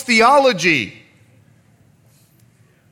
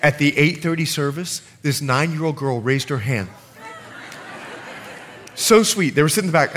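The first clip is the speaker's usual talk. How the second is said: normal talking voice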